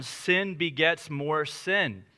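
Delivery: very slow, word by word; slow dictation. Shown as a demonstration. Pastor dictates a point to note takers, very slow. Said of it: "if you're a note taker".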